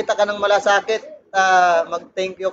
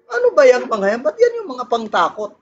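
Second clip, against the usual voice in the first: exasperated; 6 semitones higher than usual. Real, not acted. Exasperated preacher complaint.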